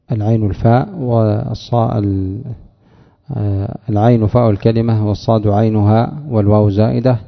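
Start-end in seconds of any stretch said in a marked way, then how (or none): none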